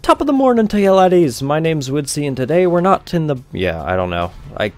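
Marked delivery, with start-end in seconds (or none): 0.0-3.4 playful mock Irish accent, higher and louder. Gamer trying an Irish accent on viewer request, mock Irish. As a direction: ham up a stage-Irish greeting. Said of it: "wanted me to speak in an Irish accent".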